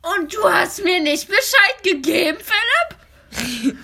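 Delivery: loud, high furious teacher; teacher angrily scolding Philipp for not telling her; angry. Acted.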